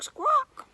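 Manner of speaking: very high, loud squawk; teacher squawking like a parrot for children. Pretend. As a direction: screech it like a parrot. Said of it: "And parrots go squawk".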